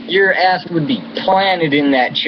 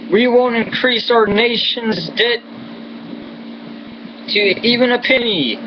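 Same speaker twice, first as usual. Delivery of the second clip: higher mock presidential voice; sarcastic impression of the president, pitched up; 8 semitones higher than usual. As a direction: parody his promise in a raised pitch. Mock quoting the president's promise.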